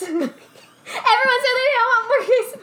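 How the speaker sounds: high laughing complaint; laughing exasperation. Real, not acted. A server laughs to her cohost about the queso order, pitched high. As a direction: laugh through the outrage.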